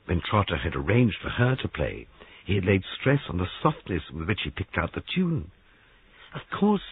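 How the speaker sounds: ordinary delivery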